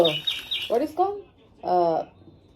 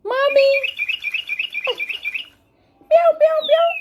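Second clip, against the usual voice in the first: put-on high baby talk; faster than usual. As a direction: squeal the meows up high for the baby. Cooing to baby.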